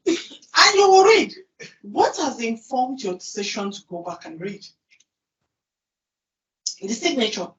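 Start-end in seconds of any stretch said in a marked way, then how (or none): 0.1-1.3 high, loud, excited exclaim with a laugh; shocked and half-laughing at the big title; startled surprise. Retold or imitated. To the class, playing someone amazed by a CEO signature, high excited surprise.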